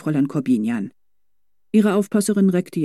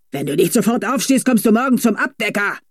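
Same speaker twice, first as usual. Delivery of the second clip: shouted threat; 6 semitones higher than usual. A narrator's reading. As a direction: yell it at the stubborn horse. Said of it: "schrie er".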